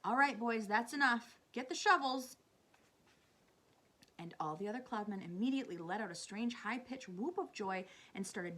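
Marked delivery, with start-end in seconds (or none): 0.0-2.4 loud foreman's call to the crew; shouting. A narrator's reading. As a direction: shout orders to the boys. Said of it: "they heard him shouting".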